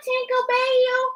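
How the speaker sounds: ordinary delivery, nothing marked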